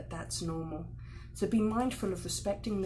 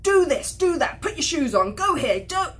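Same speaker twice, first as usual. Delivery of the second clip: loud, much higher, barked orders; harsh demands; 8 semitones higher, 11 dB louder than usual. Shown as a demonstration. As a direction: snap commands at a child. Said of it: "It's harsh".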